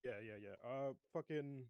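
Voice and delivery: ordinary talk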